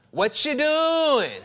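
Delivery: high, loud, slow and sing-song; high drawn-out wife voice. Shown as a demonstration. Pastor acting out his wife interrupting him, high and slow. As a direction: stretch it out like a teasing wife. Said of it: "Like that".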